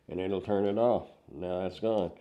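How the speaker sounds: ordinary talk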